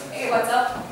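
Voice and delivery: lazy offhand greeting; way too relaxed. Shown as a demonstration. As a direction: toss it off far too casually. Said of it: "That is way too relaxed".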